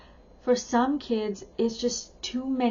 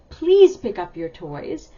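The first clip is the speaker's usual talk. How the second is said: slow, louder, friendly "Please" lifted at the start; nicer request; 2 semitones lower, 9 dB louder, slower than usual. Shown as a demonstration. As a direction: ask kindly, leaning on "Please". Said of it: "even the please makes it sound nicer".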